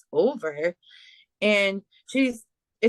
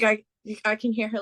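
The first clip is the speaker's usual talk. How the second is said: ordinary talk, nothing marked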